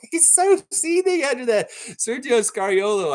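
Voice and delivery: squealing high through laughter; helpless laughter. Real, not acted. Commentator tickled by the seething coach, high laughing squeal. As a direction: crack up while talking, loud and very high.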